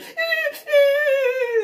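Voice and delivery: high, loud laughing sob; real teary laughter; crying laugh. Real, not acted. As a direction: laugh until it cracks into tears. Breaks up in laughter.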